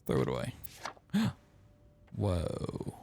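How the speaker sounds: ordinary delivery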